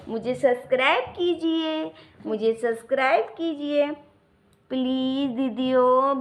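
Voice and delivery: mock-insistent baby begging; loud playful plea. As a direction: shout the plea to all the relatives.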